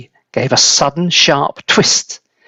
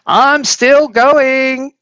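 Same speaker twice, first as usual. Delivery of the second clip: far higher, very slow screech; screechy old voice; 11 semitones higher, 3 dB louder, much slower than usual. A narrator's reading. Grandma calling from the attic, slow high screech. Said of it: "the old screechy voice from above".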